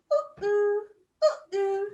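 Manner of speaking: loud, high drawn-out "ooh"; ooh sound. Shown as a demonstration. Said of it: "make the ooh, ooh sound".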